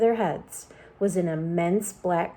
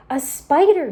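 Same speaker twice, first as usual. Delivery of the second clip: high, loud alarmed cry; cried out; 7 semitones higher, 7 dB louder than usual. A narrator's reading. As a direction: shriek it at the sight of the spider. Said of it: "she cried".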